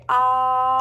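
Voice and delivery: loud, held-open ahh sound; saying ahh. Shown as a demonstration. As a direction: open wide and sing out ahh. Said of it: "Now say".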